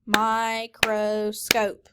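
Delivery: Microscope spoken slowly, syllable by syllable; clapped syllables. Shown as a demonstration. Teacher leads class clapping, slow syllables. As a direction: clap mi-cro-scope slowly. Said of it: "Everyone say microscope".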